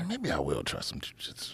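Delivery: hushed, breathy aside; whispering. Real, not acted. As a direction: whisper it half to yourself. Joking aside while watching.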